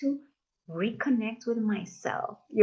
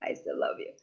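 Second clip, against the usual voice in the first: breathy hushed reassurance; tender whisper; 7 dB quieter than usual. Retold or imitated. Whispering love to herself after being late. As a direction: whisper it to yourself lovingly. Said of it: "So now I'm like".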